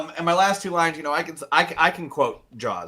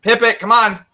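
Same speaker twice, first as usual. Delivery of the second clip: loud, high call for the dog; 11 semitones higher, 10 dB louder than usual. Retold or imitated. Calling his dog like in the movie, loud high shout. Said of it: "so I have to say".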